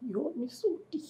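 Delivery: soft, unvoiced wounded words; wife whispers her pain to her husband; hurt whisper.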